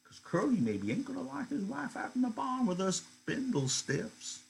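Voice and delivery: high, put-on young woman's voice, teasing; mocking wife brushing off the bindle stiffs; Curly's wife. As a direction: lift the voice high and tease the men.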